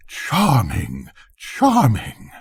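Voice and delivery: second charming soars high; flattering cries. A narrator's reading. Courtiers praising the king's flute, high gushing cry. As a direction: gush over the king's bad flute. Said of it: "everybody cried out".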